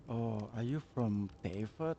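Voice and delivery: ordinary talk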